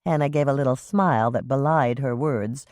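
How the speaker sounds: usual speaking voice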